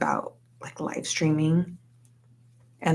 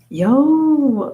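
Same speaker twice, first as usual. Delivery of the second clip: high, loud, drawn-out whoop; hyped-up exclamation; excited cheer; 9 semitones higher, 8 dB louder than usual. Real, not acted. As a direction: stretch it into a rising hype call. Cheering on their own streaming streak.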